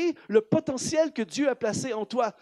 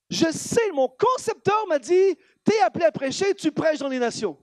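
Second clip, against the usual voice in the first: high, loud preacher's declaration; forceful preaching; 4 semitones higher, 4 dB louder than usual. Real, not acted. Preacher answering his critics about his calling, forceful. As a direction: proclaim the calling with conviction.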